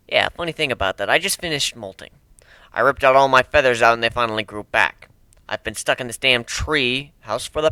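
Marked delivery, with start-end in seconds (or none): none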